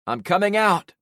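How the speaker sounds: loud call across the street. A narrator's reading. Narrator calling out to friends at the wall, loud. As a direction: shout it so they know you. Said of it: "I called".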